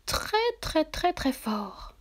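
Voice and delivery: each "très" pitched high and separate; insistent repetition. A narrator's reading. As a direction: hammer "très" in a high voice, easing down to "fort". Répétition insistante du conte. (« très » répété, aigu et insistant)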